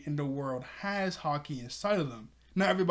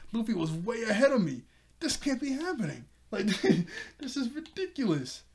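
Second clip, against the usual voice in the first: mock disbelief; 7 semitones higher than usual. Usopp arrives first, shocked.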